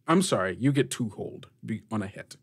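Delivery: ordinary delivery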